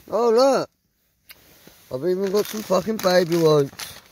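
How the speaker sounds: ordinary delivery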